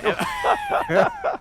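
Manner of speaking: high shrieking laugh. Real, not acted. Laughs at the big win.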